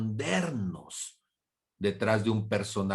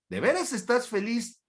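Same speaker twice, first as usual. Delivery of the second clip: incredulous question; 11 semitones higher than usual. Acted. Mocking a fake happy mask.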